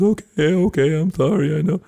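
put-on high little-kid apology; imitating upset child. Father imitating his scolded son apologising, high and upset. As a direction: put on a small boy's pleading high voice.

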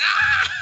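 wild high shrieking laugh; very old faint voice. Pretend. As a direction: shriek like an unhinged old lady. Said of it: "You sound like that old lady from Something About Mary".

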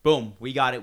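loud, confident brag; cocky driver. Retold or imitated. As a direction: play the sure-footed LA driver.

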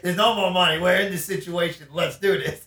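loud, mock-indignant arguing; retold yell. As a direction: argue back as if yelling at her. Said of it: "supposedly I yelled at her".

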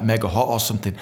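ordinary talk

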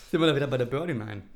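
ordinary talk

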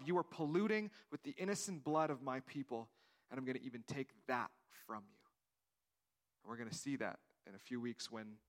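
ordinary talk

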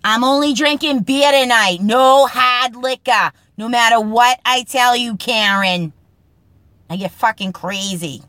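put-on a Boston guy swearing off hard liquor, in a loud Boston accent. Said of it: "Boston Speak segment, Kid lines".

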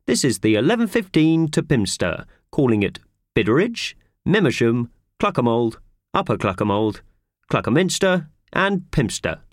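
a lightly voiced guard listing stops; a mild hint of a rail announcement; loud train announcement. A narrator's reading. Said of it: "said a loud voice".

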